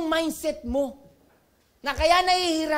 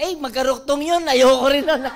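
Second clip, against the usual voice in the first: put-on loud childish refusal; 10 dB louder than usual. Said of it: "Sabi ko".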